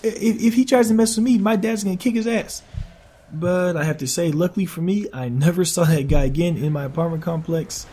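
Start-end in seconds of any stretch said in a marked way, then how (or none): 0.0-2.6 mocking friend. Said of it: "and he's like".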